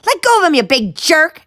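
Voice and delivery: high, loud furious yell. Acted. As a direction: defend her friend. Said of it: "Ella yelled".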